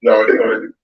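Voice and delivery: ordinary talk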